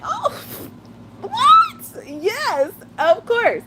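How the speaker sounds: very high, squealing mock delight; hammed-up excited squeal; mock surprise. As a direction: act thrilled to be offered a filter. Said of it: "I'm like".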